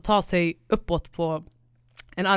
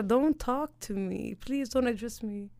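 slow, softer, dismissive plea; slow mock brush-off; 3 semitones higher, 6 dB quieter, slower than usual. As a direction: drag it out, tired of them. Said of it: "det är så här". (långsam, trött avvisning)

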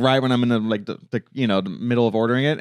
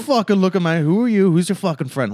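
hammed-up suspicious challenge, pitched up; mock tough guy; 7 semitones higher, 3 dB louder than usual. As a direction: play an offended Italian hothead. Mock Italian tough-guy confrontation.

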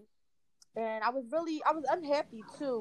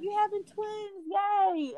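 imitating excited nurse; 9 semitones higher, much slower than usual. Nurse cheering twins news.

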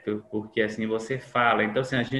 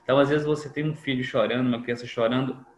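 ordinary talk

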